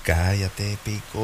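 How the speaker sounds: a gruff inner voice shushing him; comic low hush to himself; hushing alter ego. Acted. Fake voice hushing the host.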